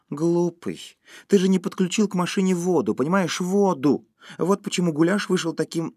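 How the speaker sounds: high-pitched exasperated wife; emphatic, high, lively reproach; scolding. A narrator's reading. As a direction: scold him as if it's obvious. Wife scolds husband over water.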